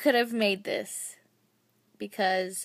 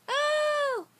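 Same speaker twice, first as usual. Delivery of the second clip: surprised. Real, not acted. Spotting a gift in the box.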